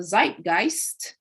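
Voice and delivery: ZEITGEIST drawn out slowly, each half punched; "zeitgeist" slowly, with heavy emphasis on both syllables; emphatic. Real, not acted. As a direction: stretch ZEIT-GEIST into two deliberate, stressed beats. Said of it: "had to say that with emphasis".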